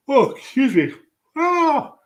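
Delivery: real yawn, pitch jumps high. Real, not acted. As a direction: yawn through the words. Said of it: "little yawn going on".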